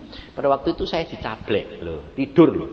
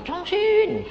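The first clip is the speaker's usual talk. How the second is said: high, loud call of a name. Retold or imitated. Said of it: "Suaranya keras sekali".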